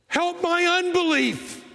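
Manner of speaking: high, slow cry of help my unbelief; desperate plea. Retold or imitated. Preacher voicing the father begging Jesus, high and slow. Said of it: "what the man says next".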